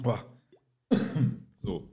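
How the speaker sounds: recovering after choking on a drink; catching breath after swallowing wrong; just choked. Real, not acted. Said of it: "kurz verschluckt".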